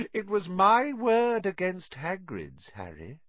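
gloating. A narrator's reading. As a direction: gloat over your win. Riddle gloating after laugh.

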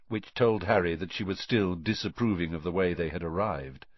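ordinary delivery

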